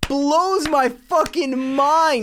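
high, drawn-out amazement; amazed disbelief. Real, not acted. Friend reacting to a wild confession, amazed disbelief. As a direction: stretch it out high, truly blown away.

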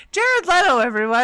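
big showbiz announcement; loud announcement. Pretend. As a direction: belt it out like a host. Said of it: "you should announce our silver fox".

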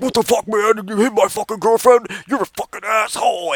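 high-pitched mock outrage; a hint of an angry muscle guy; angry boyfriend. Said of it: "this guy is already like".

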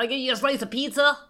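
high, loud hammed-up New York accent; mock New York. Mocking the documentary's New Yorkers, New York accent. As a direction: play a brash New Yorker selling pizza. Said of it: "sound like they're from New York".

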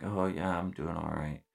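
struggling marine answering the leader, subdued; kinda down. Retold or imitated. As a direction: answer flatly, energy gone. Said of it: "And it's kinda down".

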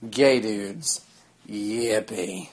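deadpan quiet yippee; flat, unimpressed cheer; sarcastic. Retold or imitated.

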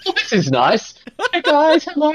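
put-on high, cheery, oblivious kid-brother voice; clueless little brother. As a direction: greet everyone like a clueless younger sibling. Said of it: "clueless little brother just going".

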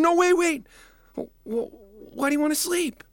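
high, loud urgent plea; pleading. Acted. Boy pleads with the king, high and loud. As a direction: beg him not to sleep.

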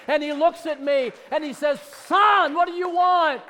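loud, high, driving preacher's cry; shouting declarations of faith to the congregation; full-force preaching. Acted. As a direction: preach it out loud with rising fire.

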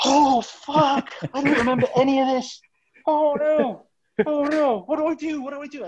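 sharp gasp, then high, dismayed wailing; acted panic. Hungover crewman learning what he did, mock panic.

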